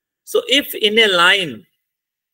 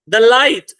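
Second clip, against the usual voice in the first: "light" stressed higher and louder after "The"; unstressed "The", then a punched "light"; 5 semitones higher, 8 dB louder than usual. Shown as a demonstration. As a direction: lean hard on "light", lifting it up. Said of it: "the unstressed है, उसके बाद stressed आ रहा है".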